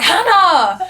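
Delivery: high, loud exclaim; calling partner's name in shock. Retold or imitated. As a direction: call her name out in alarm. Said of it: "And I was like".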